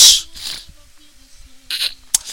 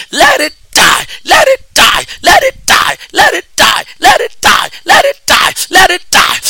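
shouting; 8 semitones higher than usual. Real, not acted. Prayer decree shouted over listener.

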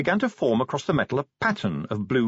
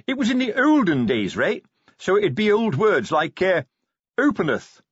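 high, emphatic know-it-all voice; Fred Colon, eager advice; 9 semitones higher, 4 dB louder than usual. A narrator's reading. Colon explains old words to Vimes, high and eager. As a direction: pitch it high and insistent.

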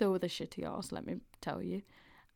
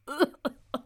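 burst of laughter; 6 semitones higher, 3 dB louder than usual. Real, not acted. Reacting to own gross story.

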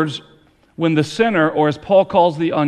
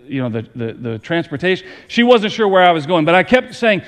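usual speaking voice

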